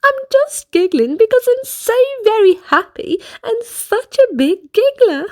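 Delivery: chirpy little giggler; high giggly voice. Acted. Little Miss Giggles explains herself to the manager.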